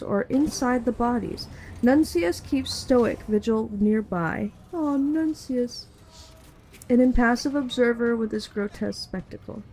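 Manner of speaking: steady, measured scene-setting read; game master narration. Acted.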